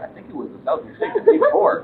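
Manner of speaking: high, laughing mock whine. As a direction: mimic him in a silly high voice. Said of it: "you're like".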